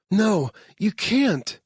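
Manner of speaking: drawn-out high no; high, slow protest. Acted. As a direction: plead slowly against it. Claire protests, strapped down.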